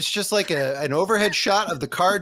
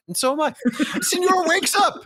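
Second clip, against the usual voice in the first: ordinary delivery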